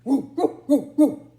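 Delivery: loud woo woo dog bark; Bottomley Potts barking. Acted. Dog answers the yowl with loud barks. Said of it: "said Bottomley Potts".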